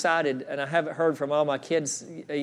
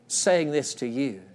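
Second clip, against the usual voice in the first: ordinary delivery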